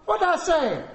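high, loud mock interrogation; exasperated parent. Dad quizzing the child who disobeyed, exasperated. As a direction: demand it from a naughty child.

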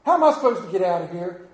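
a retold angry outburst, hurried; imitating anger. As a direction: rattle off the gripes like someone fed up and lost. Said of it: "Then you become angry".

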